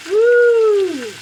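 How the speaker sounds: a high, loud woo; excited whoop. Real, not acted. Showing the frying meat, a high loud woo. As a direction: holler at the sizzle. Sauce sizzling.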